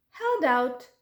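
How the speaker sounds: ordinary delivery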